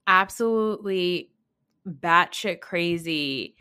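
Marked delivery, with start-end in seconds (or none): none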